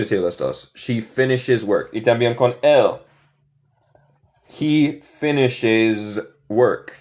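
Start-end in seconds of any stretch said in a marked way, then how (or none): 4.5-6.9 slow, careful teacher demo; slow model sentence. Shown as a demonstration. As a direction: say it slowly for learners. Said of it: "teacher models slow sentence".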